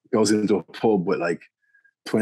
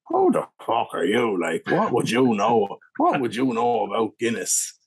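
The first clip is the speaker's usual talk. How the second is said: lightly put-on Irish pub regular, challenging; very Irish impression; 2 semitones higher than usual. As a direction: imitate an indignant Irish drinker. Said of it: "this would be a very Irish impression".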